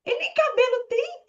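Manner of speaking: high, playful mischievous whisper-free urge; teasing inner voice. Retold or imitated. Her inner voice urging her to mock the director, high and cheeky. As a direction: egg yourself on like a cheeky little devil. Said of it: "minha mente falava".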